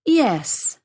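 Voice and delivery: ordinary talk, nothing marked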